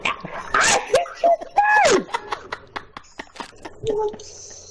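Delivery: high strained drawn-out yell; raging gamer. Real, not acted. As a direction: wail it in outrage. Complaining about lost trade.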